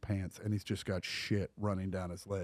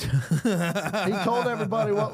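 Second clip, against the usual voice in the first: laughing through it; 10 semitones higher, 6 dB louder than usual. Real, not acted. Still laughing while defending him.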